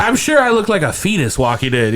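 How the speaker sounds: ordinary talk, nothing marked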